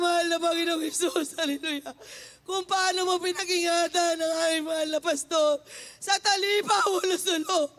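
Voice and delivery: loud, pleading prayer cry; desperate prayer. Retold or imitated. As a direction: plead with the Lord loudly for protection. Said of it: "Sabi ko". (malakas na pagsusumamo sa panalangin)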